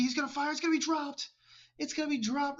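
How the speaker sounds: rising excitement calling the play; excited call. Real, not acted. Caster calling a dropped pass, high and excited. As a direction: pitch jumps way up as the pass flies.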